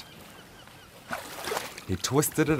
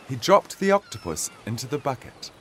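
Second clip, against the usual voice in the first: ordinary delivery, nothing marked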